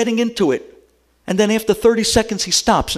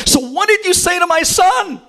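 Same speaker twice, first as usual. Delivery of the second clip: demanding shout; 11 semitones higher than usual. Retold or imitated. Father demanding on phone.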